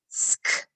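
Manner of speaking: "Sk" said as a hiss and click with no voice; voiceless blend. Shown as a demonstration. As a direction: breathe out "Sk" unvoiced.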